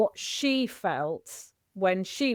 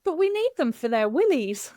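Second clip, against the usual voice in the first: a higher, louder imitation of mum's blunt line; 5 semitones higher, 4 dB louder than usual. Daughter retelling her mum's outrageous remark, voiced up. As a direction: deliver mum's cheeky line with relish. Mum's punchline in retold call.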